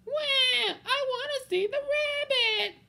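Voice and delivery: put-on high wailing little kid; child crying. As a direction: bawl like a disappointed toddler. Child wails for the rabbit.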